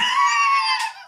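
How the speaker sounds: big loud laugh, high up; a loud delighted laugh; burst of laughter. Real, not acted. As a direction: crack up at the joke. Laughs at Arlene's joke.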